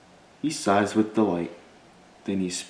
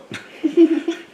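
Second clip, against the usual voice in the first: high laugh; 7 dB louder than usual. Acted. Laugh after uh-oh.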